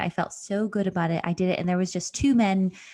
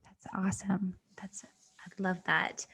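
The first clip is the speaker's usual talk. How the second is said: whisper; 9 dB quieter than usual. Real, not acted. Whispered reply in interview.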